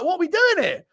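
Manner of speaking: high-pitched, incredulous question; mock bewilderment. Pulled onto the dance floor by a stranger, high mock bewilderment. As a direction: squeak it out as if baffled.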